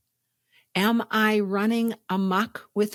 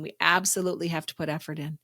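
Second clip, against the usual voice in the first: ordinary delivery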